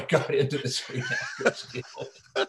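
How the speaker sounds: words dissolving into laughter; laughing. Real, not acted. Actor wrapping up how he got into the guild, laughing. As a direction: finish the sentence through a real laugh. Laughs at own story's punchline.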